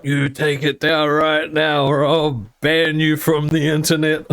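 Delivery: slow, high stern threat; threatening mum. Retold or imitated. Mum threatening to ban her son, slow stern voice. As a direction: lay down the law.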